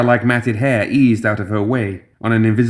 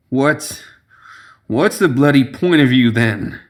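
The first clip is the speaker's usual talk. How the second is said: put-on slurring drunkenly; 3 semitones higher, slower than usual. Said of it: "he said, slurring his words".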